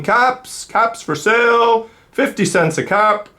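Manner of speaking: high, slow street-seller call; calling out wares. Acted. Peddler calling down the street, high slow call. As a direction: call it out like a peddler selling caps.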